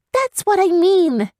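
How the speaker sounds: put-on high, troubled girl's voice; young girl haunted by guilt; upset young girl. Girl character voice.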